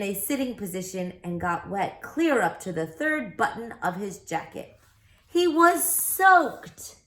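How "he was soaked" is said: comic soaked line. Acted. Narrator hams up the policeman.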